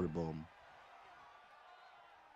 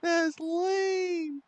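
much higher, squeaky fake sob on That's lame; mock crying whine; 5 dB louder than usual. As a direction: whimper That's lame like a hammed-up crybaby. Mock-crying over a game glitch.

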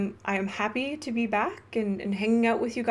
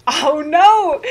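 mock alarm; 6 semitones higher, 10 dB louder than usual. Real, not acted. Reacting to chat teasing her.

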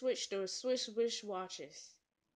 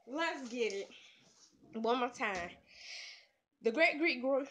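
normal talking voice

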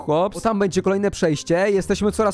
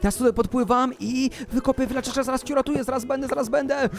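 urgent hushed hurry; 6 semitones higher, faster than usual. Pretend. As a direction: rush it under your voice, sneaking by. Swimming hastily past monsters. (cicho, wysoko, w pośpiechu)

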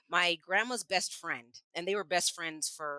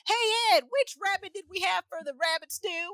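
calling out to husband; 11 semitones higher than usual. Retold or imitated. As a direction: yell the line out to Ed, high and casual. Godmother calls to husband.

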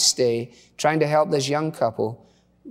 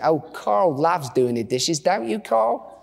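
put-on Australian accent; 3 semitones higher, slower than usual. Wife volunteering husband for dishes, Australian accent. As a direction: put on an Aussie wife's accent. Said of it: "say this with an Australian accent".

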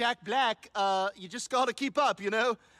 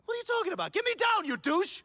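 much higher furious shout; angry yelling; 10 semitones higher, faster than usual. Acted. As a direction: demand to be let down. Angry from roof.

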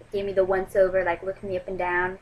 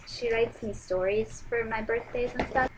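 ordinary delivery

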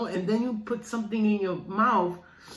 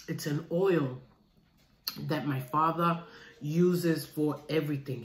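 ordinary delivery

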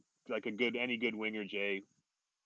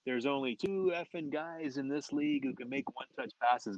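mock yelling; 4 semitones higher than usual. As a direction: imitate a coach chewing out a player. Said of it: "Pat starts yelling at me".

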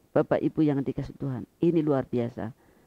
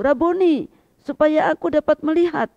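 high and loud, begging to see; a blind beggar's loud, high plea; pleading cry; 11 semitones higher, 7 dB louder than usual. A narrator's reading. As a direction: beg with all your heart, loud and high. Blind man answers Jesus.

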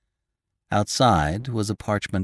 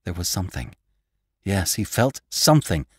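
plain reading voice

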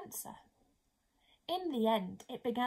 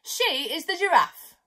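lightly voiced bird, a bit louder; pelican answering; 3 semitones higher, 6 dB louder than usual. Pelican introduces the giraffe, lightly voiced. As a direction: answer it proudly. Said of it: "the pelican answered".

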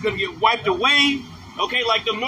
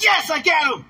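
higher, mock celebratory cheer; happy gloating; 6 semitones higher, 3 dB louder than usual. Vengeful spirits cheering over a catch, happy and higher. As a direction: exult like a spirit that caught its man. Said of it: "They get happy. They're like".

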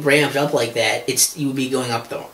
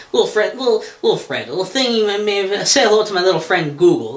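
higher, theatrical gangster parody; mock Scarface line; 7 semitones higher than usual. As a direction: say it like a movie gangster.

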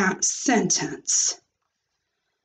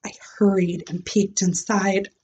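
usual speaking voice

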